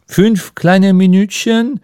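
a small, slow, timid question; meek. Acted. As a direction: shrink a little as you ask. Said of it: "sagte Ardant kleinlaut".

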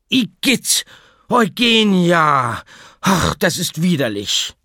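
high, loud disgusted squeal, dragged on the name; squeaking disgust. Acted. Girl squealing at the bat in disgust, loud and high. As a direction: squeak out your revulsion. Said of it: "kiekste auf".

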